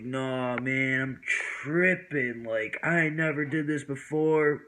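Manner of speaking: slow, heavily stretched words; acted stoned confession. Acts stoned self on phone.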